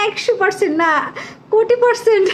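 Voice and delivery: high, excited read-out breaking into laughter; delighted exclamation. Retold or imitated. Delighted reading of viewer's boast. (উচ্ছ্বসিত হাসিমাখা গলায়)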